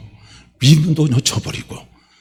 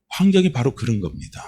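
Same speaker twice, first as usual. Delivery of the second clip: normal talking voice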